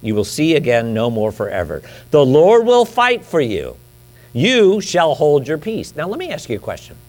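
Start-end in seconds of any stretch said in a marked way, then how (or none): 2.1-5.9 slow, emphatic promise; solemn sermon proclamation; preaching. Acted. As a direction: draw each word out with conviction.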